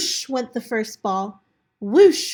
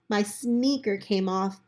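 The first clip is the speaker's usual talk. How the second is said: ordinary delivery, nothing marked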